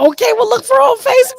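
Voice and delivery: real giddy excitement; excited squeal. Real, not acted. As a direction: gush the plug in a thrilled squeal. Host excited sign-off.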